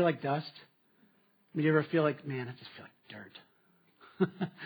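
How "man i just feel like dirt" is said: slow, soft, low moping; mock gloomy sigh. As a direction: drag it out like you feel like dirt. Someone feeling like dust.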